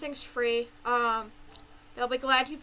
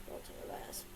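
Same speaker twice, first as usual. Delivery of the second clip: breathy whispered repeat; 14 dB quieter than usual. Real, not acted. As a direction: whisper it to yourself.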